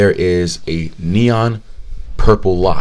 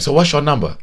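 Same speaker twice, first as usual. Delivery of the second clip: high mock Vietnamese accent; hopeful question in an imitated Vietnamese accent; 6 semitones higher than usual. Salon owner asking for his number, mock Vietnamese accent. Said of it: "thick, thick Vietnamese accent".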